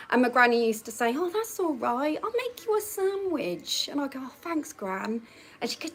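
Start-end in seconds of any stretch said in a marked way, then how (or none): 1.2-3.9 higher, softer and slower, a lightly voiced doting granny; kindly granny. Granddaughter imitates her granny offering a sandwich, softly higher. 4.2-5.2 a soft, much quieter "Oh, thanks, Gran", playing poorly; feeble thanks. Truanting girl softly thanks her gran, mock poorly.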